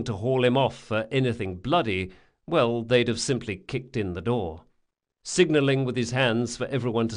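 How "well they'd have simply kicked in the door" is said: ordinary delivery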